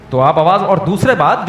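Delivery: usual speaking voice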